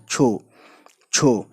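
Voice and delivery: छो with lips rounded; short rounded o in छो. Shown as a demonstration. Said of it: "होंठों को गोल करना पड़ता है".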